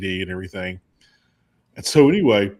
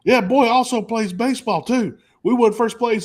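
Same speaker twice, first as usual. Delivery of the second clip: much higher, louder bragging dad; 12 semitones higher, 6 dB louder than usual. Retold or imitated. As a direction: cut in proudly with the baseball news. Redneck dad voiced in story.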